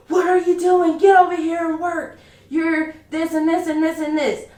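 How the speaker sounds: mock the shift lead snapping orders at you; retold angry yell. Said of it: "anybody who knows me knows I don't like being yelled at".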